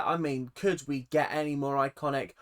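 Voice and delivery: usual speaking voice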